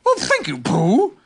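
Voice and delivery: high, grateful creature voice; thanks in a swooping animal voice; Tigger. A narrator's reading. Tigger thanks Pooh for offering haycorns, high character voice. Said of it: "said Tigger".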